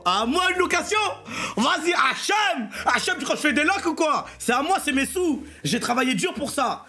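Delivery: high, offended outburst at the idea it's rented; playing up wounded pride, half laughing; indignant protest. Answering passers-by who think it's rented, mock-indignant.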